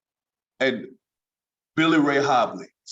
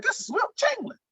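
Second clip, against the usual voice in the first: much higher, awestruck voice with no bass; starstruck squeak; 3 dB quieter than usual. Retold or imitated. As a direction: gasp it out like a starstruck rookie. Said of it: "he changed your whole voice".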